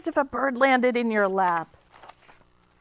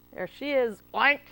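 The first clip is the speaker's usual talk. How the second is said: plain reading voice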